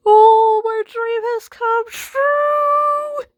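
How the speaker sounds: gleeful gloat. Acted. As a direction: crow over it like a dream come true. Teasing friend gloats over lost speaker.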